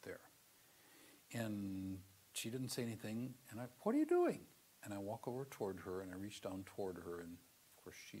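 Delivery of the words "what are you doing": much higher, louder grumpy call up the stairs. Retold or imitated. Grumpy father finds his daughter packing her bag, loud call. Grumpy dad asks again.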